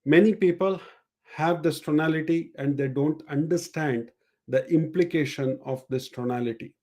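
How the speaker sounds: ordinary delivery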